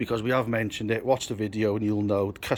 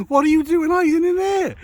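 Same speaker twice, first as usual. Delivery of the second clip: calling out; 8 dB louder than usual. Real, not acted. Finding Brad hiding.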